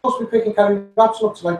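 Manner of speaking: ordinary delivery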